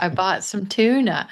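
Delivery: "tuna" stressed, falling at the end. Shown as a demonstration. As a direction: lean on the last word "tuna". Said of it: "Our stress typically falls on that last word".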